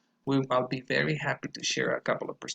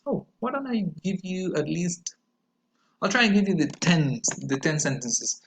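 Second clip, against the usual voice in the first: usual speaking voice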